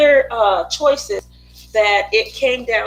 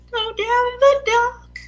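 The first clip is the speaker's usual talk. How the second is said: much higher, lilting repeat; high sing-song; 10 semitones higher than usual. Pretend. Streamer imagining walking into the picture, high sing-song. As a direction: lift GO DOWN THE DOCK up high.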